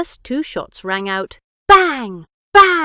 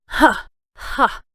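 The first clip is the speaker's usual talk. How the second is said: louder scornful ha-ha; mocking laugh; 3 semitones higher, 5 dB louder than usual. A narrator's reading. Jack laughs scornfully at Biggs, mocking him. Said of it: "Jack laughed".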